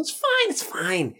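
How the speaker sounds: high, slow lazy sing-song; quoting Jake. Retold or imitated. Quoting Jake's catchphrase, high and slow. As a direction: drag it out like an easygoing buddy. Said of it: "That whole".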